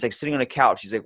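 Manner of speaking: ordinary talk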